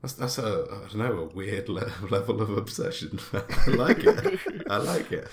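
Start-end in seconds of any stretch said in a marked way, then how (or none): 3.3-5.3 drawn-out laugh through the words. Real, not acted. Player laughing at John's obsession, laughing approval. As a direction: say it giggling, slow.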